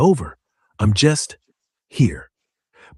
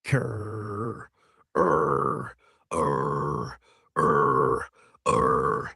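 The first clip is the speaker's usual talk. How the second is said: dinosaur voice; 10 semitones lower, 6 dB quieter, much slower than usual. Pretend. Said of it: "What's the adult pterodactyl?".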